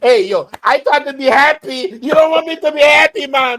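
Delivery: put-on high, loud Dominican-accented protest. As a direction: plead it in a hammed-up Dominican accent. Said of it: "I start talking like that".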